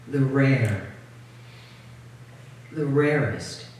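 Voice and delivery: slow, spaced-out poetry reading; poem reading. Acted. Poet reading her poem to an audience, measured poem reading. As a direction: set each short phrase apart with long pauses.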